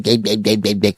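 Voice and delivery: gurgle nonsense syllables; mock baby babble. Baby babbling at mom, lightly mocked. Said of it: "it comes out more like".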